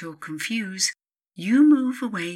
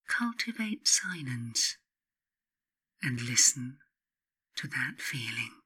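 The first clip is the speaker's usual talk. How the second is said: whisper; 3 semitones lower, 4 dB quieter than usual. Acted. Urging silence, whispered.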